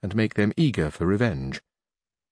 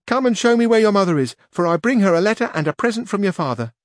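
high, louder page voice; young page voice, raised and loud; 10 semitones higher, 4 dB louder than usual. A narrator's reading. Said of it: "said the page".

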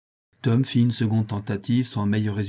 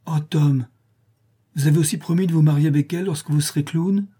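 mild cry of dismay; 5 semitones higher, 4 dB louder than usual. A narrator's reading. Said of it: "s'écria Becky".

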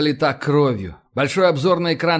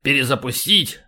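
tossed-off question. A narrator's reading. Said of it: "бросил".

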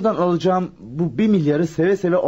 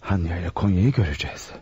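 muttered aside; 7 semitones lower, 5 dB quieter than usual. Acted. Alone, waiting, talking to himself.

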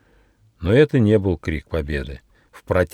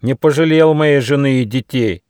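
loud, raised, bitter accusation; spiteful shout; 7 semitones higher, 6 dB louder than usual. Acted. Deer accuses the tiger who attacked his family, spiteful shout. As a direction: hurl the grievance angrily, higher and louder. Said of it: "злобно закричал олень Замбар".

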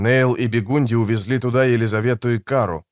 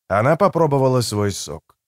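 ordinary delivery

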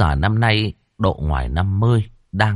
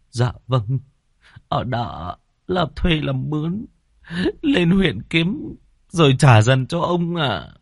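higher, slow, humble reply; timid servant; 8 semitones higher, slower than usual. Acted. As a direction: answer meekly with bowed head. Debtor humbly answers landlord. (khúm núm thưa ông)

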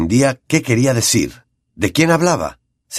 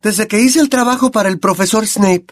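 put-on much higher girl's voice; Hermione answering; 12 semitones higher than usual. A narrator's reading. Hermione replies to Lupin, much higher. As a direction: answer firmly.

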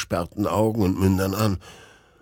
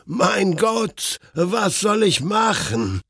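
much higher, slow, panicked outcry; terrified cry; 10 semitones higher, slower than usual. A narrator's reading. Man alone in the rain crying out in terror.

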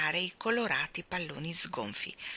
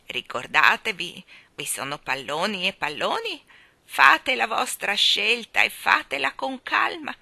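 put-on trembling old woman; 3 semitones higher, 5 dB louder than usual. Balloon woman chides the children, in a loud trembling old voice. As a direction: scold them like a frail old woman full of passion.

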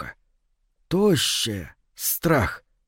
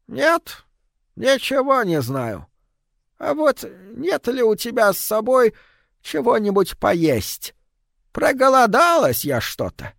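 put-on high, toothless old hag; old witch voice; 10 semitones higher than usual. A narrator's reading. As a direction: croak it like a hungry old witch.

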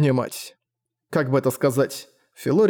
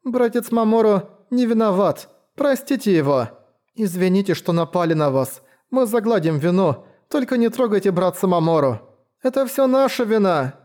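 put-on pleading child voice; 6 semitones higher than usual. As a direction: beg for forgiveness like a child. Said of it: "Дети по очереди выдавливали из себя слова". (умоляющий детский голос)

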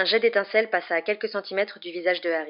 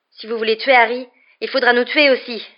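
raised, louder defiant challenge; 5 semitones higher, 8 dB louder than usual. A narrator's reading. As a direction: stand up to the threat, loud and higher. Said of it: "sur un ton de défi".

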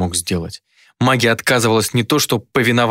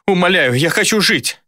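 desperate pleading; 9 semitones higher than usual. A narrator's reading. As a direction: beg for your life. Captive begs for life.

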